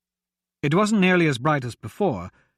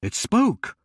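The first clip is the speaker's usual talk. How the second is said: stunned; 6 semitones higher than usual. A narrator's reading.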